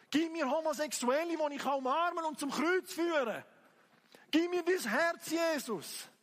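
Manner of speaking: loud, high, pleading prayer cry; impassioned preaching. Real, not acted. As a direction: cry out to God at full force. Preaches and prays at full force. (leidenschaftlich flehend gepredigt)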